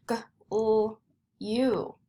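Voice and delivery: g, l, u as separate sounds; teacher's slow sound-by-sound "g, l, u". Shown as a demonstration. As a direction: break glue into "g", "l", "u" with gaps. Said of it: "Do you hear the long U?".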